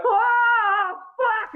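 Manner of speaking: very high tearful wail; freaking-out toddler. Retold or imitated. Dad acts out his daughter sitting there, high wailing freak-out. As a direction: mimic a toddler having a bad trip. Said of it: "I used to get freaked out".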